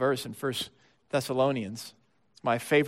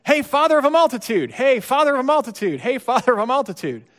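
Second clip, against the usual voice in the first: loud, high hail across a crowd; calling out; 8 semitones higher, 7 dB louder than usual. Pretend. Neighbours hailing Abraham, a loud call. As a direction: call out the name again and again, loud. Calling out his new name.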